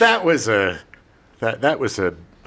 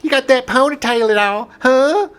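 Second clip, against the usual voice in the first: high, hearty country voice; Southern accent; 9 semitones higher, 4 dB louder than usual. Pretend. Country character greeting Lorne.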